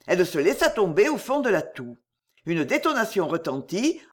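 ordinary delivery

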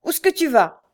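loud, high challenge; shouting. A narrator's reading. Mother blocks her son's way, shouting. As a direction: stop him with a sharp cry. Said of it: "lui cria-t-elle".

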